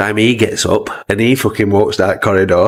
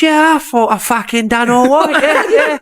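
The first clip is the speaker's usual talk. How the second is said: high laughing voice as Pearce; laughing Pearce impression. Pearce answers the manager, high and laughing. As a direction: giggle through Pearce's cocky reply.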